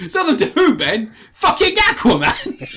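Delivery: high, loud laughing retort; mock Aquaman retort. Quoting the meme back to his friend, laughing mock retort. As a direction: bellow the comeback while laughing. Co-host quotes meme too.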